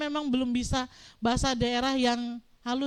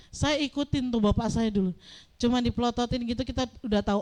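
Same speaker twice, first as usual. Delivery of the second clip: ordinary talk, nothing marked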